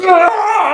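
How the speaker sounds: high-pitched mock scream; imitated agonized scream. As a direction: cry out as if pepper-sprayed. Imitates Johnson's scream when sprayed.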